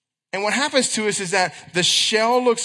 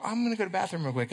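fast, soft brush-off excuse; quick excuse; 6 dB quieter, much faster than usual. Pretend. Stranger hurrying off to the bathroom, fast soft excuse. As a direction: rattle it off fast and quiet to get away. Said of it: "give you that little smile".

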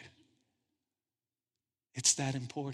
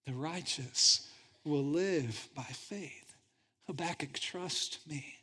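soft echo of God; 5 dB quieter, slower than usual. Acted. Echoing God's soft reply.

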